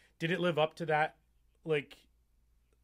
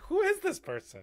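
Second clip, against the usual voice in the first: surprised; 4 dB louder than usual. Retold or imitated. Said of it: "I was like".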